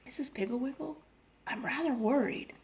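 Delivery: a worried mother on the phone, hushed; low voice. A narrator's reading. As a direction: keep it from the children. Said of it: "in a low voice".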